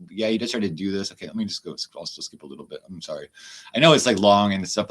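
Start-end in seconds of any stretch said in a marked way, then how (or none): 1.1-3.3 much softer, half to himself; quiet aside. Real, not acted. Apologising to the chat for skipping ahead, softly. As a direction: drop the voice for a quick sorry.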